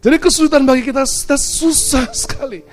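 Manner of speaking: loud, high pulpit proclamation; forceful preaching. Real, not acted. Preacher tells the congregation why it is hard, forceful preaching. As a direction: drive the point home to the congregation.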